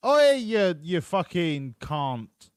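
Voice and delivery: imitating an Aussie yelling at a passing car, loud and high on Oi; mock Australian driver, shouting Oi then dragging out "you fucking can't" slowly. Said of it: "in the Australian accent".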